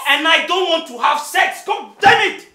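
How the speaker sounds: furious high-pitched yell. Acted. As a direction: explode and shout the partner down. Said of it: "I'm sorry for raising my voice".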